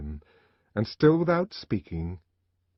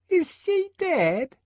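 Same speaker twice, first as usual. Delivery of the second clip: put-on high, slow child's question; the inquisitive twin asking the savage, high and slow; curious twin; 4 dB louder, much slower than usual. A narrator's reading. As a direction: ask innocently. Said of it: "he asked".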